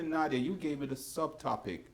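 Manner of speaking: ordinary talk, nothing marked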